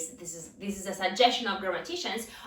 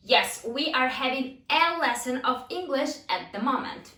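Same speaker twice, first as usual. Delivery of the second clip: higher-pitched, deliberate teacher read-out; emphatic classroom question and answer; language drill delivery; 5 semitones higher, 3 dB louder than usual. Shown as a demonstration. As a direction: read out the drill with every word set apart.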